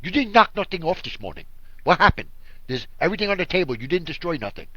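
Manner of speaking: put-on lightly done New York Spanish accent; a hint of a Spanish-accented grandmother; grandmother's Spanish accent. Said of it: "she had a Spanish accent".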